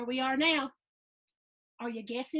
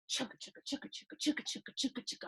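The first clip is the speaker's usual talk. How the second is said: whispered chugga; 7 dB quieter, much faster than usual. Acted. Train sound effect.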